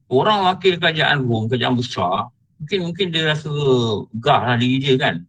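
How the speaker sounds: ordinary talk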